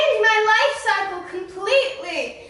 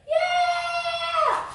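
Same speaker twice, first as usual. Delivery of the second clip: high whoop of entrance; cheering entrance; 10 semitones higher than usual. Acted. Guest cheering as he is introduced, high. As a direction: burst in with a whoop.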